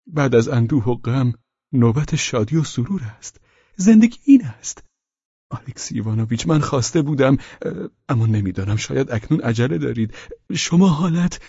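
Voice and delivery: high, excited voice turning hesitant; Pavel Pavlovich. Acted. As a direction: gush brightly, then falter politely. Pavel enthuses, then hesitates.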